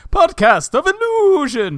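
high, loud-ish drawn-out title reveal; rehearsed announcement. Acted. Acting out mirror rehearsal, grand high slow take. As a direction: try it big and grand, stretched out. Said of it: "rehearsing how they're gonna talk".

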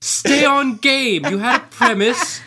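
put-on high-pitched British kid coaching; discerning British child. British child faulting the comedian's wordplay, high scolding kid voice. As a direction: scold like a tiny comedy critic. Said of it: "the most comedically discerning of children".

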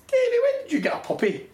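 a hint of startled wife; mock wife. Wife quoted, mock alarmed.

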